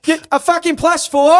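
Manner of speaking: put-on high, loud Boston-accented shout; hammed-up rage over a card game; angry Boston yell. Guest acting out playing Uno mad, Boston yell. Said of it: "when I yell".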